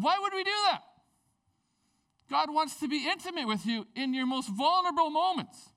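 impassioned sermon peak; preaching at full force. Acted. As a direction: plead with the congregation to run to God. Preaching climax.

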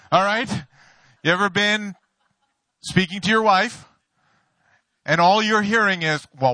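ordinary delivery

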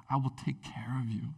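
a soft, unvoiced whisper; tender whisper. God whispers care to his hurting children. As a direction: breathe the promise close and gently.

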